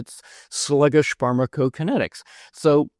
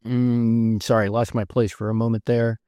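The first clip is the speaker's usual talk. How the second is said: ordinary talk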